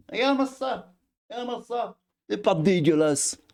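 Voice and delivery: very high put-on squeaky voice; exaggerated high-pitched mock marvelling; mock squeal. Mock admiring of sea footage.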